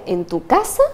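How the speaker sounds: ordinary talk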